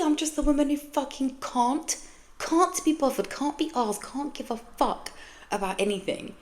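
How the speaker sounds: exasperated. Real, not acted. Exasperated rant about herself.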